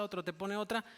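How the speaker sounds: ordinary talk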